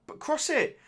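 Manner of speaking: high pitched plea at the screen; begging the winger to cross the ball; urging shout. Real, not acted. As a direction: yelp it at the player to cross. Urging his player at the screen.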